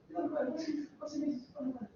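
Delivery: high, soft put-on voice. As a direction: do a hushed, high-pitched impression. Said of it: "That's my impression".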